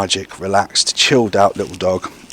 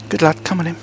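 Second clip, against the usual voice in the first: praise for a dog; 6 semitones higher, faster than usual. Real, not acted. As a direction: coax the dog in with a lifted voice. Trainer praising dog, high voice.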